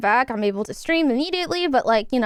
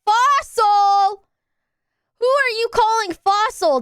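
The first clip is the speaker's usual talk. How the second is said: mock offended; 8 semitones higher, 3 dB louder, slower than usual. Indignant reply to chat insult.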